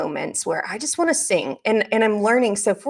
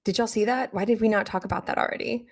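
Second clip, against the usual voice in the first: ordinary talk, nothing marked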